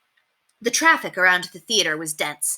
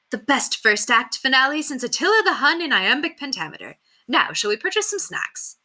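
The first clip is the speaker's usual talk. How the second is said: 1 posh theatregoer; 7 semitones higher than usual. A narrator's reading. Audience member talking in lobby.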